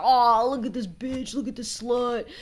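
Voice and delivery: a sneering online troll, lightly voiced; a hint of a spiteful troll; mocking Reddit troll. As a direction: sneer it like a nasty forum poster. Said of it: "and they're like".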